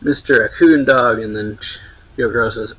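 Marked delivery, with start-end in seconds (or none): 0.0-1.2 louder mock yell of surprise; retold yell. Crew all yelling at Chopper, retold surprised yell. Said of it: "they're all yelling".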